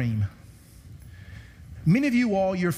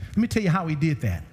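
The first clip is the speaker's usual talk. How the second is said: ordinary talk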